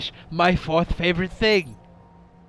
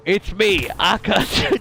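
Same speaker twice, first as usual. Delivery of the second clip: mimicking the alien's Texan drawl, louder; 4 semitones higher, 5 dB louder, slower than usual. Streamer imitating the alien's Texan voice, light drawl. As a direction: play the alien with a Southern drawl. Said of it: "an alien with that voice".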